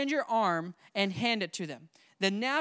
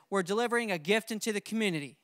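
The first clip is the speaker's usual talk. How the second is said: quick delivery line; faster than usual. Shown as a demonstration. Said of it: "It's as simple as that".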